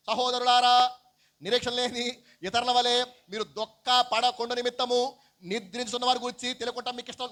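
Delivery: loud, high declaimed verse; scripture reading. Acted. Preacher reading Paul's letter to the brethren, loud scripture reading. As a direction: read it out high and ringing. Reads out the epistle.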